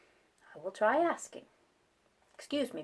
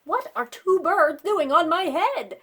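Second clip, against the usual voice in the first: louder, higher alarmed question; upset outcry; 8 semitones higher, 8 dB louder than usual. Acted. Gerald asks Piggy about the birds, upset outcry. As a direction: demand it in dismay.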